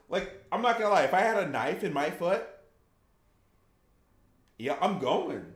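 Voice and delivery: ordinary talk, nothing marked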